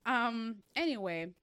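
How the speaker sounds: ordinary delivery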